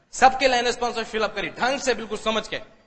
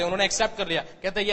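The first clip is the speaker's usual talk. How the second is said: normal talking voice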